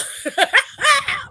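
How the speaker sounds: a burst of high, loud laughter; real high laugh. Real, not acted. Laughing at the retold taunt to the Miz. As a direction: crack up for real.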